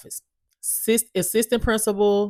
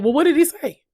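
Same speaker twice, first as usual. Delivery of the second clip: incredulous question; 10 semitones higher, 5 dB louder, faster than usual. Retold or imitated. Mother demanding details from school.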